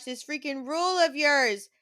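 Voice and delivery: normal talking voice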